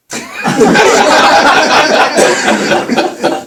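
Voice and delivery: high-pitched mischievous giggle. Retold or imitated. The kid looking back at him, high giggle. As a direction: giggle like a playful child. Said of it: "the kid just looks back at me and goes".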